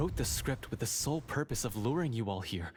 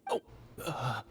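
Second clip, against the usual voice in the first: usual speaking voice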